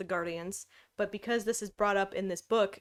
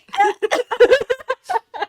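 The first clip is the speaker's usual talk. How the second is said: loud laugh; 12 semitones higher, 13 dB louder than usual. Real, not acted. Laughing at the book.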